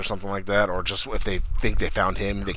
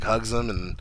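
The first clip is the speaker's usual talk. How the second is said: ordinary delivery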